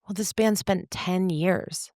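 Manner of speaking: ordinary talk, nothing marked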